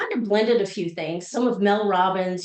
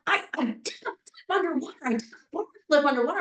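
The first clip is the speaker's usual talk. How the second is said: little daughter; 5 semitones higher, faster than usual. Retold or imitated. Daughter tells her news.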